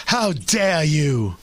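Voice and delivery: slow, lightly voiced mock Greta; a hint of an indignant teen activist, drawn out slowly; Greta Thunberg impression. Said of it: "My Greta Thunberg is right on".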